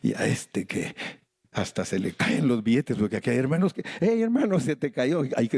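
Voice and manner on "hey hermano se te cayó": mock call out. Mimics congregant noticing dropped cash.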